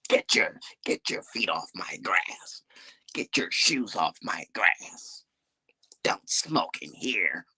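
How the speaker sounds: put-on slow, whispered grumpy old man; old hater. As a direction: creak it out like a cranky old neighbour. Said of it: "He sound like an old ass hater".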